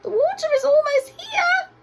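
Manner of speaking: squeaky giraffe shouting a warning; high squeaky shout. A narrator's reading. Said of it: "he squeaked in his rather high voice".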